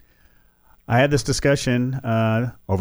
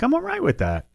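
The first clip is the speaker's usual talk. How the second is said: pitched way up, airily unbothered; sing-song high shrug; breezy high retort; 11 semitones higher than usual. Retold or imitated. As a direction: toss it off high and carefree. Mocking reply to Trump critics.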